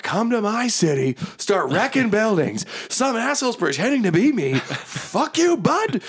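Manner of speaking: very high, indignant warrior rant; high-pitched battle taunt; irate Zariel. Acted. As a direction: rail at the impostor in a high voice. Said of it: "Being like".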